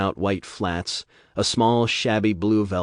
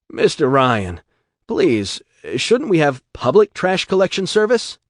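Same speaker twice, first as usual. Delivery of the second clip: pleading shopkeeper; 8 semitones higher, 6 dB louder than usual. Acted.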